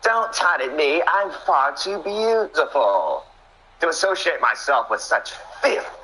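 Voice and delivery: preen with disdain in a put-on high voice; Nighttime impression. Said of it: "That's what Nighttime is saying".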